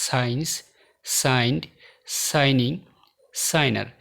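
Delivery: "সাইন্স, সাইন্ড, সাইনিং, সাইনার" with no G before N; word-by-word demo of "সাইন্স, সাইন্ড, সাইনিং, সাইনার", G dropped; silent G. Shown as a demonstration. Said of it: "প্রতিটি শব্দে জি".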